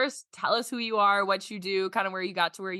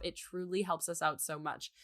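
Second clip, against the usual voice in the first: usual speaking voice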